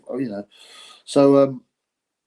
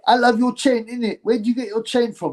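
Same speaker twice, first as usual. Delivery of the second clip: much higher, excited fan voice asking about the chain; imitating a fan; 9 semitones higher than usual. A man in the shop asks about the chain, high and eager. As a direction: play the eager stranger, pitched way up. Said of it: "He goes".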